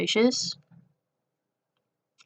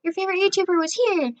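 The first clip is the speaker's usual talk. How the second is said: put-on high, quick and louder voice of an eager girl; gushing girl imitation; 12 semitones higher, 5 dB louder, faster than usual. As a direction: imitate her prying question in a raised pitch. Acted question from the pushy girl.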